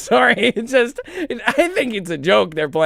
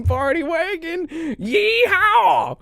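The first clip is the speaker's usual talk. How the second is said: cowboy yeehaw; 10 semitones higher, much slower than usual. Acted. Cowboy hat yeehaw again.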